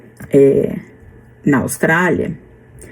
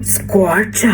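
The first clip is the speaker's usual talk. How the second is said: Australian scorch; 3 dB quieter than usual. Shown as a demonstration. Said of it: "Eles falam".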